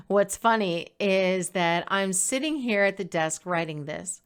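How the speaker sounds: ordinary talk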